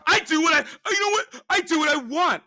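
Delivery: high whiny toddler mimic, shouted; crying tantrum. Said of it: "That sounds like a fucking toddler".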